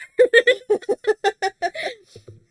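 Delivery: bursting out laughing at the joke; loud high laugh. Real, not acted. Host cracks up at the ranking joke, loud laughter. Laughs at Seth being in third.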